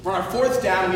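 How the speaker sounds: usual speaking voice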